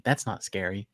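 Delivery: ordinary talk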